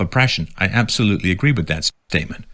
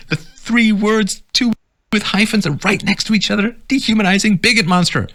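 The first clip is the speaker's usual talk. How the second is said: pitched way up in disbelief; higher incredulous rant; 11 semitones higher than usual. Real, not acted. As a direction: squeak it out, baffled. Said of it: "all caps this in my voice".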